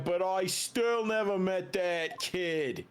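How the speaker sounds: high, combative Jersey tough guy; thick Jersey accent. Acted. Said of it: "how uncomfortably thick his Jersey accent is".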